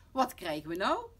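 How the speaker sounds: loud, indignant mother; angry call. Acted. Mama walks in on the children, angry outburst. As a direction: burst out crossly at the kids. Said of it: "roept ze boos".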